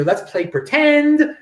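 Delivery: slow, lightly voiced gentle children's host; drawn out like a kids' show host inviting play; a hint of a soothing TV neighbour, slowed right down; Mister Rogers. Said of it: "Mister Rogers used to talk about".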